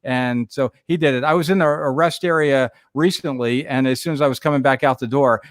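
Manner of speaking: ordinary talk, nothing marked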